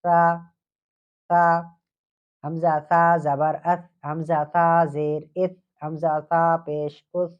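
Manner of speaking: slow, deliberate drill of سا with its vowels; tajweed teacher drilling sa; drilling the light letter. Shown as a demonstration. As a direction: spell out حمزہ سا زبر اس slowly, sa kept light. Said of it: "اس کو باریک پڑھتے ہیں".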